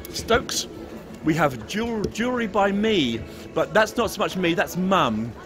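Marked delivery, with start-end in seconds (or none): none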